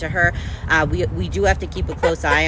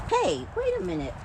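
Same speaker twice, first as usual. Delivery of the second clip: sudden surprise; 5 dB quieter than usual. Real, not acted. Suddenly noticing something with the chickens.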